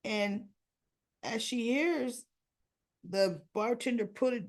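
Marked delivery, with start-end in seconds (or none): none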